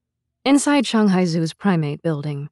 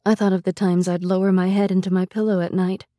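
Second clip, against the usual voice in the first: ordinary delivery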